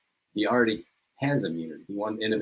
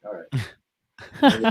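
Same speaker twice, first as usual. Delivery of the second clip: mock resigned; 6 semitones higher, 7 dB louder than usual. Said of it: "I'm like".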